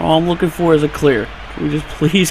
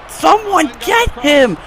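frantic shout; 8 semitones higher than usual. Real, not acted. Panicked call to defenders.

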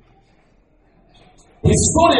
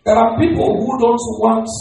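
normal talking voice

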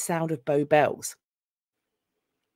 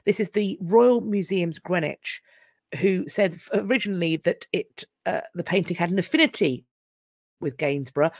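usual speaking voice

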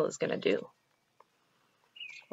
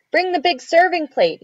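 loud, raised-pitch order; commanding father; 11 semitones higher, 11 dB louder than usual. Acted. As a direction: call out the order to the children. Hungry father orders the children.

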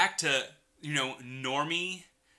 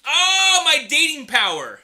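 mock anguish; 9 semitones higher, 14 dB louder than usual. Cries out at splattered face.

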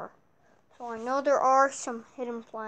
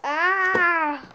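pained yell; 5 semitones higher, 8 dB louder than usual. Acted. Hit in game.

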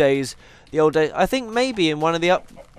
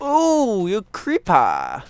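mock villain sneer; 3 semitones higher, much slower than usual. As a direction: sneer at the creeper, slowly. Spots a creeper.

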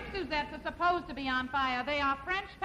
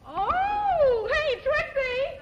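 much higher, louder thrilled squeal; delighted call; 11 semitones higher, 7 dB louder, much slower than usual. Acted. Wife calls her friend to see the crepes, thrilled.

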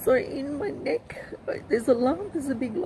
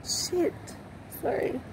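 high, tearful apology; real crying, voice pitched up; 7 semitones higher, 6 dB quieter than usual. Real, not acted. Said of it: "I don't mean to cry".

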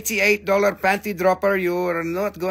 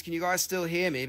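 ordinary delivery

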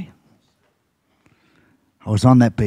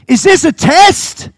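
much higher, louder and slow; preaching shout; 5 dB louder, slower than usual. Shown as a demonstration. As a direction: thunder the question at the whole church.

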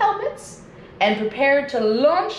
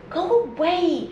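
mild shout; 7 semitones higher than usual. A narrator's reading. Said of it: "shouted the old man".

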